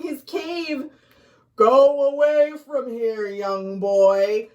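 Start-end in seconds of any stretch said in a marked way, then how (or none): 1.6-4.5 a louder, put-on creature voice, warning the boy off; ghoul shouting back. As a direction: shout it while hiding and trembling. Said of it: "shouted back as he trembled".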